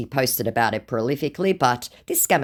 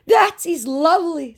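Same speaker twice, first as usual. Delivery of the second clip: mock scammer voice; 4 dB louder than usual. Woman reading the scammer's message aloud, in a high mock voice. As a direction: ham up the creepy flattery.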